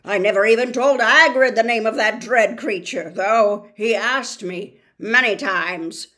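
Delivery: put-on loud, otherworldly old spider voice; ancient giant spider. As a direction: boom it out like a huge ancient spider. Aragog continues speaking.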